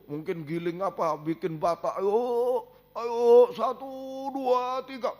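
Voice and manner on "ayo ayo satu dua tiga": lightly voiced overseer counting, higher; hammed-up slave driver barking a count; preacher mimicking an Egyptian overseer driving Israel to make bricks, higher; mock taskmaster.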